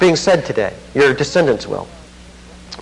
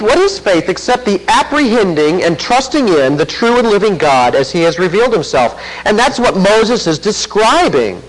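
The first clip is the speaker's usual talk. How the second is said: raised, higher pitched preaching voice; fervent preaching; 8 semitones higher, 3 dB louder than usual. Acted. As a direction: press it home to the congregation. Preaching climax on faith and heart.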